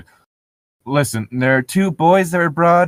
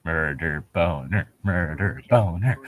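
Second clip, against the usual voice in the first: low, slow teasing mutter; 8 semitones lower, 5 dB quieter, slower than usual. Real, not acted. Player teasing the nurse, a low slow mutter. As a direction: murmur it under your breath, slow. Joking aside about the nurse.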